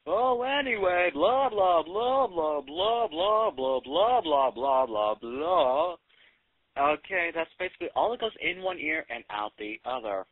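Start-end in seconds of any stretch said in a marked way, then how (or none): 0.1-6.0 louder mock boss blah blah; droning boss again. Mocking the boss at the meeting, loud droning blah blah. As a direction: blather on like the boss who won't stop. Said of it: "Mister Boss Man?".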